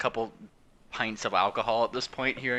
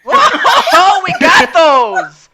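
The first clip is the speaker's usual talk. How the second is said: much higher and louder, slow, laughing; laughing pirate; 20 dB louder, much slower than usual. Acted. Pirate boasting to the captain, laughing. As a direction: crack up mid-boast.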